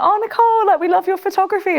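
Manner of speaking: gushing brand rep. Retold or imitated. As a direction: play the over-eager Levi's staffer, voice up high. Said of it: "and they're like".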